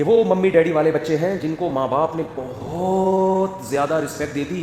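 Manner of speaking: very slow, sing-song mockery of mummy-daddy kids; mocking drawl. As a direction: drag it out to ridicule them.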